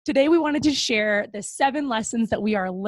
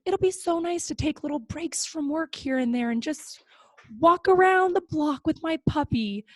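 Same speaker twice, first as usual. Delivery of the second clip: dreamy imagining; 6 semitones higher than usual. Retold or imitated. Quotes own rosy daydream.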